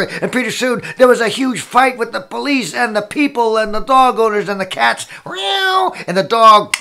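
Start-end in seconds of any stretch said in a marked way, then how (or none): 5.3-5.9 high drawn meow; cat yowl. Acted. Storyteller voices a cat in the huge fight, high meow.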